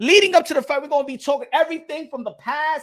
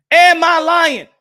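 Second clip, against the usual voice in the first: loud challenge; 3 semitones higher, 9 dB louder, slower than usual. Real, not acted. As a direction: hit AM hard and demand an answer. Repeats question, now loudly.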